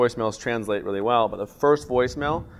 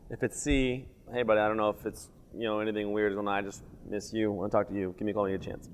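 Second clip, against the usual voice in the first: softer, heartfelt, just missing a friend; sincere voicemail; 3 semitones lower, 9 dB quieter than usual. Shown as a demonstration. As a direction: drop the joke and say it gently, much softer. Heartfelt end of joke voicemail.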